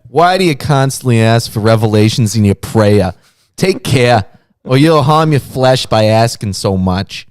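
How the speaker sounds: put-on loud Jersey or Boston tough guy accent; a man's man with a strong East Coast Boston accent. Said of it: "like, uh, American Italian".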